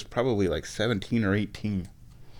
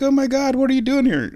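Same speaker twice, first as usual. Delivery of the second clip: very high, louder mock amazement; retold excited greeting; 5 dB louder than usual. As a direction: act out a friend's thrilled surprise. Retold shocked greeting from old friend.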